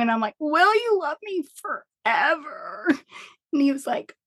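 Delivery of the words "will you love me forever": high, wavering plea, half-crying; tearful plea. Retold or imitated. Pregnant wife asks husband if he loves her, high and tearful. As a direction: replay her own teary question to her husband, high and wobbly.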